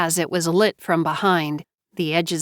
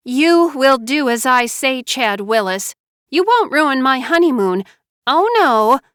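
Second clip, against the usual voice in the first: higher, louder spoiled demand; bossy pouting Mara Joy; 6 semitones higher, 4 dB louder than usual. Acted. Bride ordering her husband, high pouting command. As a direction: pout and insist like a spoiled bride.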